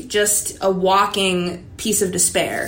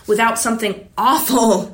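normal talking voice

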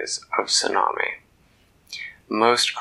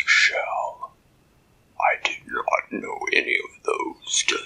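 slow, hollow, breathy monster voice; a giant eyeing a treasure; kraken voice, covetous; 6 semitones higher, slower than usual. Acted. Kraken covets Dak's mariner's shell, slow hollow monster voice. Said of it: "in that deep underwater voice".

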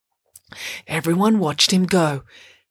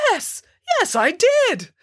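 eager claiming; 12 semitones higher than usual. A narrator's reading. Bramwell seizes undeserved credit.

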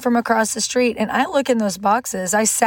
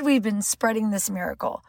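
usual speaking voice

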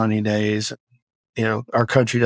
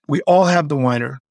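usual speaking voice

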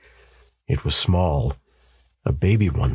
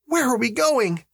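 much higher wife's voice; anxious wife. A narrator's reading. Wife asking her husband where they are fleeing, anxious. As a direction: ask as a bewildered wife.

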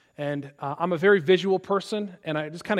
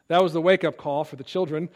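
ordinary delivery

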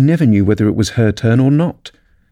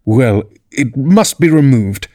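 slow, firm royal decree; very decidedly; 3 semitones higher, slower than usual. A narrator's reading. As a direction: state it with finality. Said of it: "said the King very decidedly".

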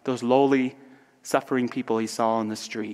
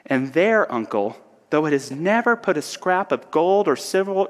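impassioned, raised dramatic reading; nephew's heartfelt speech; 3 semitones higher than usual. Acted. Nephew defending Christmas to his uncle Scrooge, impassioned. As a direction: build an earnest case, pitch lifted. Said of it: "The nephew continues".

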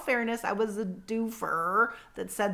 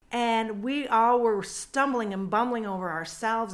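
ordinary delivery

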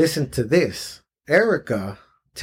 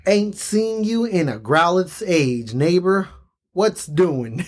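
lightly put-on rural twang, higher; folksy drawl attempt; 4 semitones higher, slower than usual. Bill greets Red in a hammed-up rural twang. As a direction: read it like a folksy country neighbour. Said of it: "I'm gonna try to read this".

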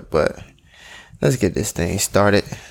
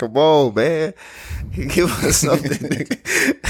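laughing through the words; giggling plea; 6 semitones higher, slower than usual. Real, not acted. Laughing at reluctant friend.

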